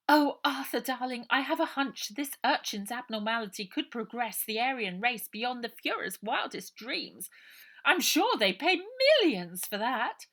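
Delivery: honey-sweet voice hiding malice; treacly Missus Buller. A narrator's reading.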